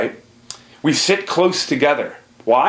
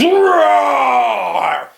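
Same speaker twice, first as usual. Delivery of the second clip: loud, high shouted lion roar; 13 semitones higher, 5 dB louder than usual. Acted. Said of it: "he drops to his knees and he growls".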